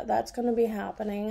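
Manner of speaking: ordinary talk, nothing marked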